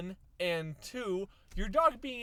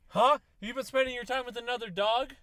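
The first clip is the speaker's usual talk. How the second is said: loud, high accusing dog; the dog loudly accusing the owner of cheating; jealous dog; 5 semitones higher, 6 dB louder than usual. Retold or imitated. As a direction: confront the owner like a jealous lover. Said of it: "dog being like".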